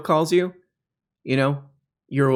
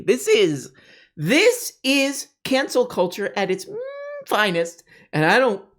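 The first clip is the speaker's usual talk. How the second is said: high, slow, whiny mock indignation; mock outrage; 9 semitones higher than usual. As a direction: whine it like a wounded pundit.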